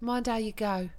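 lightly demonstrated Cockney farewell. Shown as a demonstration. Englishman models a phrase for guest, light Cockney. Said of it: "specifically Cockney phrases".

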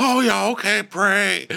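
high, put-on agreeable voice; mock-nice Shep. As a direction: squeak out forced agreement. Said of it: "he's trying to be nice to Taylor".

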